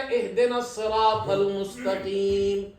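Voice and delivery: very slow, melodic chanted verse; Quran recitation. Shown as a demonstration. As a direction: draw out each word in tajwid chant.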